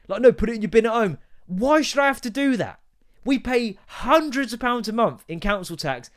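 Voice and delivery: high-pitched, incredulous comeback; exasperated, stretching the key words; indignant rant. Acted. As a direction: argue back, voice climbing in protest. Ranting back at commenters' suggestion.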